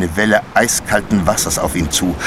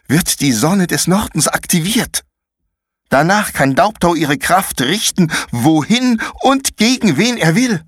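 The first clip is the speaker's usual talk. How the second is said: put-on much higher, urgent girl's voice; tense girl explaining; 10 semitones higher than usual. Paula urgently explains the ritual to Konrad. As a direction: warn him what is coming.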